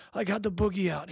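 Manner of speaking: put-on high, loud, huffy little-kid boast; dramatic huff. A narrator's reading. Three-year-old proudly reports to dad, huffing dramatically. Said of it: "he huffed dramatically".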